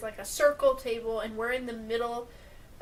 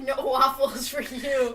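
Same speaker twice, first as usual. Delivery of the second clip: laughing; 6 dB louder, slower than usual. Retold or imitated. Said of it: "she's like".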